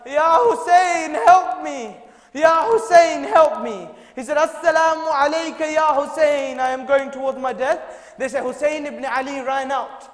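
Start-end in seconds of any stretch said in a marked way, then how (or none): 0.1-3.9 loud, much higher desperate cry; the dying companion's desperate call to his Imam; crying out for help. Retold or imitated. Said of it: "he called out". 4.4-8.1 impassioned farewell. Retold or imitated. Dying farewell to Hussein.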